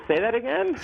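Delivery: high, incredulous ask to repeat; stunned disbelief. Retold or imitated. To Henry on the old set, stunned disbelief. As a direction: ask again as if you misheard.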